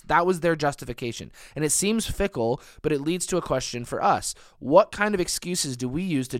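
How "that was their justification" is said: usual speaking voice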